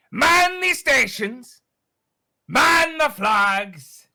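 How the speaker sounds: rally the whole crew from the deck; pirate captain shout. Acted. Captain bellowing orders to his crew, loud and high.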